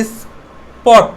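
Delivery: "স্পট" with a plain p after s, drawn out; unaspirated p. Shown as a demonstration. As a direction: say "স্পট" slowly, no puff on the p. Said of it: "পয়ের মতো উচ্চারণ হবে".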